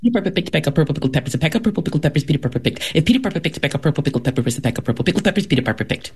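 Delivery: rapid-fire, unbroken recital; virtuoso top speed. Shown as a demonstration. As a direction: rattle off the tongue twister live, extremely fast. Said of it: "can you do that in real life right now".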